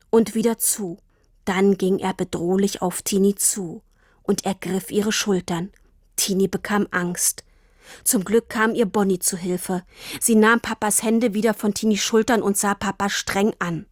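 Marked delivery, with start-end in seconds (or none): none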